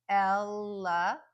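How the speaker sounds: elephant slowly, full el then two schwas; careful teacher's elephant, drawn out; schwa syllables demo. Shown as a demonstration. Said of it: "Those last two syllables are just schwa".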